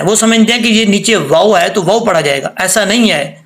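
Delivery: ordinary talk, nothing marked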